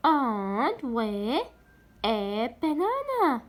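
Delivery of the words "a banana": slow, stretched teacher reading of a banana; slow demo for pupils; slow model reading. Shown as a demonstration. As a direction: draw out a banana for repeating. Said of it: "حرف صامت خلينا قبل A".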